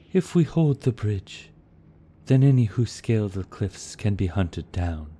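a hint of a wise leader giving orders; Eladin, steady commander. A narrator's reading. Eladin insisting to his captains, lightly voiced lord.

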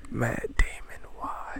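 hushed, mock-betrayed whisper; dismayed whisper. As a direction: whisper it as a stunned viewer. Acting out viewer's dismay.

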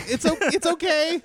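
high, halting attempt at comforting; flustered reassurance. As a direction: re-enact guiltily backpedaling to soothe someone.

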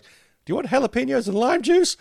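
high squeaky nerdy server; squeaky nerd voice. Retold or imitated. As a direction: imitate a squeaky nerd asking. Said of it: "the squeaky nerd voice".

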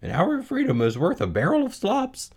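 high goose lecture; squawky high-pitched goose plea; honking goose. Acted. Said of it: "honked the goose".

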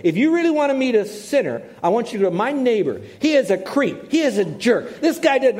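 man in his garage griping about his neighbor. Retold or imitated. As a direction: rant about the creep next door. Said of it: "I'm telling you this as he said it".

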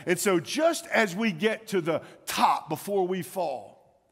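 normal talking voice

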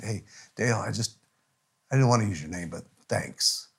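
low, hushed aside; quiet private thanks. Retold or imitated. Pastor thanking a friend pulled aside, low and quiet. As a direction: say it softly, just to him.

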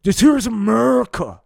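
high, loud puppet Nixon bellow; shouted high cartoon Nixon. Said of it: "Dick Nixon's like".

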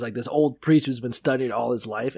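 ordinary talk

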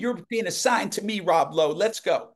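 put-on high Southern-accented order; Carl Killingsworth. Southern man claims the page for himself, imitated Southern accent. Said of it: "that's how Carl talked".

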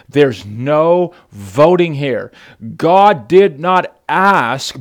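each word slow and weighty; slow emphasis. Real, not acted. Preacher to congregation, slow weighty emphasis. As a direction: lay it down slowly, word by word.

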